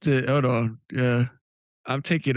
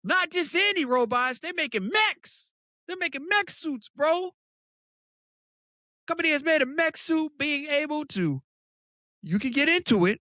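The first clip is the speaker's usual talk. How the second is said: excited; 11 semitones higher than usual. Real, not acted. Excited about mech suits.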